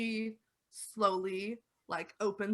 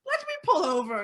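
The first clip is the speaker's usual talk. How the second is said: put-on high, loud, worried old lady; 6 dB louder than usual. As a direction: play a doubtful old woman.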